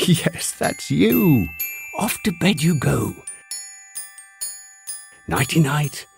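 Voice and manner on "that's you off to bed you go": usual speaking voice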